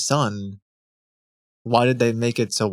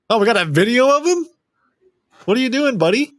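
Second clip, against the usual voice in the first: high, loud and quick surprise; amused shock at the clip; surprised; 11 semitones higher, 6 dB louder, faster than usual. Real, not acted.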